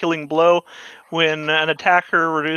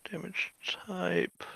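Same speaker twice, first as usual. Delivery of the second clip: whispered under his breath; whispering; 3 semitones higher, 15 dB quieter than usual. Real, not acted. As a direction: mutter it in a whisper. Reading the handbook to himself.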